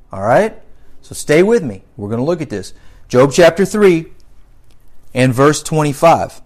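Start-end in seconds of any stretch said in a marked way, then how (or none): none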